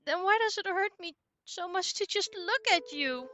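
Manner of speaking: hurt sister. Acted. Sushi asks Ao, high and hurt.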